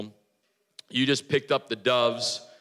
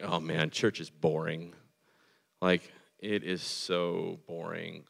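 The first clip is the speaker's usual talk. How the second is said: bored complaint; 7 semitones lower, 5 dB quieter than usual. Retold or imitated. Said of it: "they're like".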